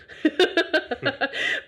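airy laughter; breathy laugh. Real, not acted. Laughing at [name] joke.

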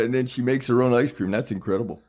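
ordinary talk, nothing marked

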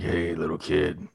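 slow, low, soft creep voice; mock a creep, softly and slowly; creepy. Co-host mimics a creep calling a kid, creepy. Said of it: "What, talking creepy?".

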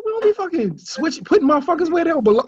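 high indignant rant, rushing the end; high mock scolding of the team; mock angry uncle. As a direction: imitate the fed-up uncle scolding. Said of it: "he said".

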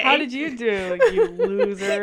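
high, loud, laughing jeer; mock taunt. Friend mocking the first place runner, laughing high jeer. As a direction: tease the winner as if he said it, cracking up.

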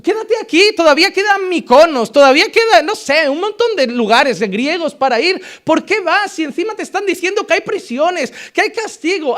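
súplica de los amigos, mucho más aguda; amigos suplicando. Retold or imitated. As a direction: ruega a Pablo que no vaya. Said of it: "lloraba y le decía". (los amigos suplican llorando a Pablo, voz aguda)

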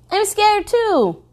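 high, slow mock fear; wry inner thought. A narrator's reading. Narrator admitting her own fear, wry mock-scared. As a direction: play the inner line with dry humor. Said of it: "I thought".

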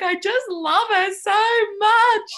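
very high, loud, drawn-out mock wail; comic re-enactment of a hysterical cry, slow and squealing; hammed-up hysterical bawling. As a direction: wail it out as if sobbing uncontrollably with love. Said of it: "literally bawling, like, hysterical".

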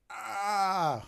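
high, loud cry of frustration; mock anguished cry. Luke learning the necklace is gone, mock anguished cry. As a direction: cry out high, hamming up the despair. Luke's reaction to lost necklace.

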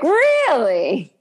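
a touch of outrage; mild mock disbelief. Retelling Monica's reaction, mild mock disbelief. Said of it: "I was like".

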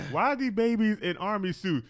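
high, loud incredulous question; mock bewilderment. Mother returns to babies in army suits, mock bewildered. Acts out returning woman's reaction.

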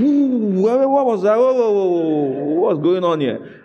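hammed-up sudden jolt of surprise; startled. Spouse suddenly moved to pray, startled. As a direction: stammer it out as if something just hit you.

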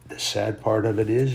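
normal talking voice

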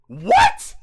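squeaky, loud shocked cry. Real, not acted. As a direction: yelp in disbelief. Shocked at Russian number.

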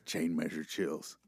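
low, quiet inmate voice; deep hushed con talk; tough guy voice. Pretend. Holds Chain inmate voice.

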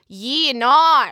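loud, broad Aussie ringtone catchphrase; Australian accent. Shown as a demonstration. Actor mimicking her friend's ringtone, loud Australian accent. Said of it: "it goes".

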